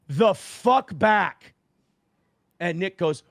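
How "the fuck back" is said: slow, louder, higher bark; retold threat. To Nick with a baseball bat, a slow louder threat. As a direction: growl it out slow and hard. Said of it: "and I go".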